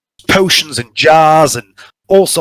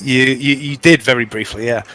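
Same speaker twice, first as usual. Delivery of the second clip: normal talking voice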